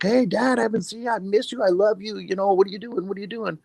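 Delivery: high-pitched imitation of an eager child; excited son. Son greeting his dad over the fence, imitated excited child. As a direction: pipe up like a thrilled kid, voice held high. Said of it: "He's like".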